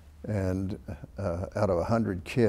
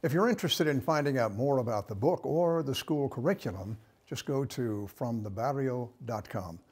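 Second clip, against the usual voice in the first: upbeat host promo read, pitched higher; radio outro read; 5 semitones higher than usual. Acted. Host teasing the next segment after the break, announcer read.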